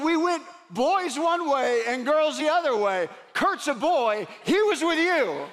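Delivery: a touch of an incredulous mother pressing her point; exasperated mom. Retold or imitated.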